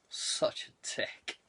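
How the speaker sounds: breathy whispered insult under the breath; a slow whispered put-down; whispered aside. Acted. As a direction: hiss it out slow as a private jab. Muttered aside about Ollie.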